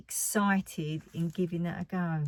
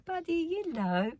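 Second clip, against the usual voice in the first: much higher sing-song greeting; cooing at a pet; 10 semitones higher than usual. Retold or imitated. Greeting her pet chameleon Buddy, high sing-song coo. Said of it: "then I'd go".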